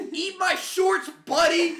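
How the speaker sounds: high, very loud comeback; triumphant yell. Retold or imitated. Heckled fan delivering his perfect line, triumphant yell. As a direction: holler it as the winning blow. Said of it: "hit him with the perfect line".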